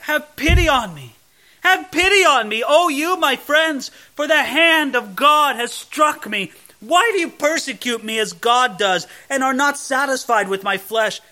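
pleading. Acted. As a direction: beg the friends for pity, loud and aching. Pleading verse read with feeling.

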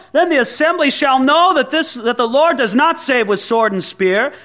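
high, loud, ringing proclamation; bold scripture reading. Acted. Preacher reading David's challenge to Goliath, loud and ringing. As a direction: declaim it like a warrior's challenge. Reads David's defiant speech at full force.

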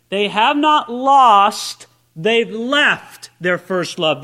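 NOT and left leaned on slowly; slow, weighted contrast: "not lost" against "left"; stress on not and left. Shown as a demonstration. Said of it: "notice it, my friends".

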